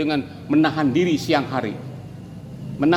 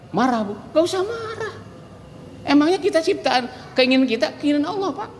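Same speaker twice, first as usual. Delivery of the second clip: high coaxing reassurance; 10 semitones higher than usual. Pretend. Preacher soothing an insulted listener.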